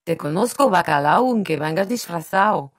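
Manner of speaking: loud, lilting Spanish saying with mock amusement; amused teasing. A narrator's reading. Brother teases his sister about her news, amused. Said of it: "Puis riant".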